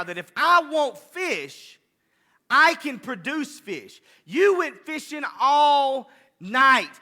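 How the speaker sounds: preacher voicing Jesus, shouting out to Peter; full-force preaching. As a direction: thunder it from the pulpit as Jesus.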